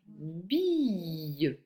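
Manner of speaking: "billes" with a long-held vowel; slow dictation. Shown as a demonstration. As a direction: stretch "billes" out for the pupil to write. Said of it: "Je vais te lire".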